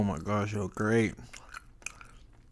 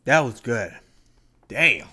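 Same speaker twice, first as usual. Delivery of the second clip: excited; 3 semitones higher, 12 dB louder, slower than usual. Real, not acted. Loud reaction to food.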